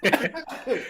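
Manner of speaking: fast, giggling through the words; can't stop laughing. Real, not acted. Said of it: "I don't know why I'm laughing".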